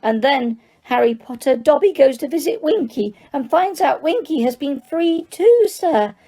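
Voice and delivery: high, bright squeaky elf voice; delighted squeaky elf. Acted. Dobby tells Harry happy news, squeaky and delighted. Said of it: "said Dobby delightedly".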